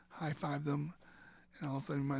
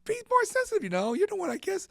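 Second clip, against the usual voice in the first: high whiny plea; 10 dB louder than usual. Retold or imitated. His whiny reply to girlfriend.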